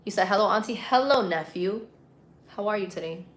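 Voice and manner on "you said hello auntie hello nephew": ordinary delivery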